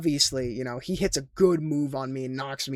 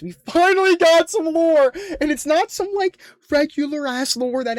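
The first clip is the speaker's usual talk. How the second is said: much higher and louder, bursting with excitement; hyped shout; 12 semitones higher, 8 dB louder than usual. Real, not acted. Streamer hyping viewers about finally getting lore, excited shout.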